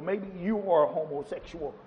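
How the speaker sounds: usual speaking voice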